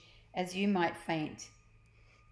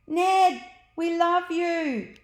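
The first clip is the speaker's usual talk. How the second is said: high, loud, slow adoring scream; screaming kids; 11 semitones higher, 9 dB louder, much slower than usual. Acted. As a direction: scream it up at the sky with love. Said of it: "they screamed".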